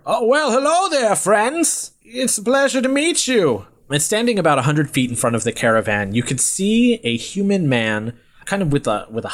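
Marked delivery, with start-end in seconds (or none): 0.1-3.7 high-pitched, hearty hail from afar; friendly roadside stranger. Acted. Old man hails the caravan's travellers, high friendly call. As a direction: greet them in a high, sing-out old man voice. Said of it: "you can hear this voice".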